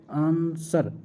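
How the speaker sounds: आंसर said slowly and clearly, with no w sound and a falling end; pronunciation demo. Shown as a demonstration. Teacher demonstrates the silent letter in आंसर for students. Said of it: "आंसर में कौन सा अक्षर silent है?".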